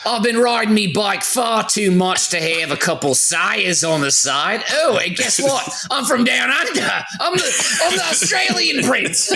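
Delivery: put-on a British accent that slides into Australian, ending high and laughing; British accent drifting Australian; slipping British accent. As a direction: start posh British, drift down under. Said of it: "I can never stay in my British accent".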